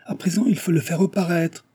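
high-pitched demand from the crowd; crying out. A narrator's reading. Guests demand Jean be made to reappear, crying out. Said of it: "crièrent enfin quelques voix".

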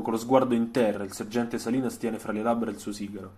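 normal talking voice

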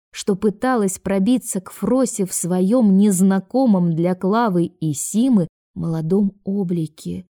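ordinary delivery, nothing marked

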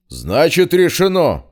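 loud decisive shout; громкий возглас. Acted. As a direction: bellow it like a leader. Петрович решительно объявляет. (громкий решительный возглас)

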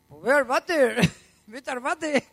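loud, drawn-out comic impersonation; rey Juan Carlos. Retold or imitated. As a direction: bellow it as a mock king. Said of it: "le dijo Juan Carlos". (imitación del rey a voces)

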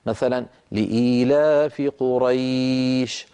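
لإيلاف قريش slowly, the ay of قريش held; slow recital. Shown as a demonstration. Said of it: "تعالوا ننطق". (تلاوة لإيلاف قريش بمد اللين)

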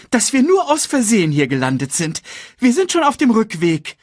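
high, loud, nervous pleading; anxious assurance. Acted. Sasu assures hyenas they landed by mistake, nervously placating. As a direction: talk your way out with a high, anxious voice.

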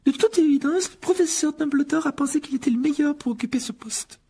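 put-on very high girl's protest; high-pitched. A narrator's reading. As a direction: argue primly in a squeaky register. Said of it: "voix un peu plus aiguë". (protestation d'une voix très aiguë)

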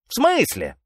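high-pitched baffled question; puzzled. Acted. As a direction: ask it as if you didn't follow. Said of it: "недопонял он".

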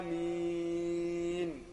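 آمين with the first vowel held two beats, then a slow مين; measured two-beat آ in آمين; correct amin. Shown as a demonstration. A teacher demonstrating the correct amin, drawn evenly. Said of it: "تقوم تقول إيه؟".

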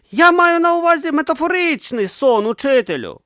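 high, excited inventor voice; eager young inventor. A narrator's reading. Urn dreaming of taming lightning, high and eager. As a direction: dream out loud, high and bright. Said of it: "пояснив Урн".

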